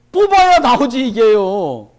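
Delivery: loud, high preaching punch; forceful shout. Acted. Pastor shouting that the sword must be drawn, forceful. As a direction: drive the point home with a shout.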